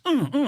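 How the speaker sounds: two short high-pitched grunts, imitated; imitated vocal tic. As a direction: mimic sudden involuntary tic noises. Imitates his son's tic noises.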